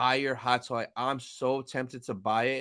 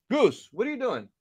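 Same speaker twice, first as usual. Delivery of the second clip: pet talk; 10 semitones higher, 3 dB louder than usual. Real, not acted. Talking to pet cat.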